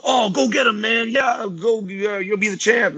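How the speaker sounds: mocking people who cheer him into competing, hammed-up shout; mock hype man. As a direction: ham up the pushy fan yelling go get him.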